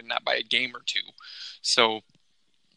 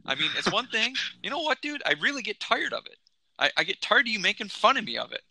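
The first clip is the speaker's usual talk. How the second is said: heated rant; 6 semitones higher than usual. Acted. Heated mock rant at cohost.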